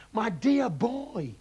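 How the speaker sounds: very slow, loud and rapt; dad gushing to his son; excited cry. A narrator's reading. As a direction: savour it with glee. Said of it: "cried Mister Killy Cranky".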